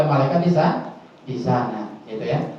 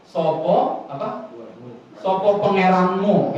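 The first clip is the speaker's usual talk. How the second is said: put-on slow Javanese-accented question; Javanese-style slow questioning; 2 semitones higher, slower than usual. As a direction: ask it the Javanese way, drawn out. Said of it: "kalau orang Jawa ngomongnya gimana".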